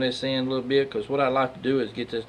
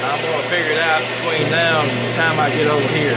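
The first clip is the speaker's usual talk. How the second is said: loud and higher, over machinery; raised voice over noise; 4 semitones higher, 4 dB louder than usual. Real, not acted. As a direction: project over a running lathe. Talking over the running lathe.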